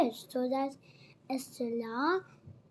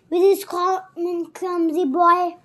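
loud, higher, contemptuous outburst; scornful girl; 4 semitones higher, 10 dB louder than usual. Acted. Horrified Estella scorning Pip to Miss Havisham, loud and higher. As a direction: sneer at the clumsy boy.

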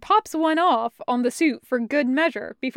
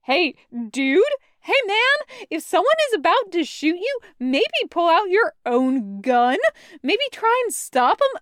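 exasperated scolding; 6 semitones higher, 3 dB louder than usual. Acted. Taco scolds wounded Kravitz.

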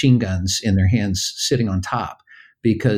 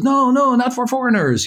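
stern soldier; 12 semitones higher than usual. Retold or imitated. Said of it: "the military man goes".

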